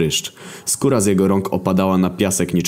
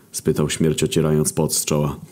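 plain reading voice

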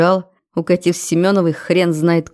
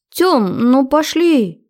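high eager pleading; pleading girl; 8 semitones higher, much slower than usual. Acted. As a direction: coax him onto the dance floor. Girlfriend pleading to dance.

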